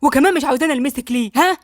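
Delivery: irritated, confrontational challenge; acted annoyance, pushing for an answer; angrier. A narrator's reading. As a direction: challenge her, losing patience. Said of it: "عمر اتعصب اكتر".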